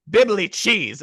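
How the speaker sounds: high, loud character catchphrase; Lala. Retold or imitated. Actress showing the Teletubby's expletive, high loud baby voice. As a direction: blurt it as an excited children's-show creature. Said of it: "And he also says".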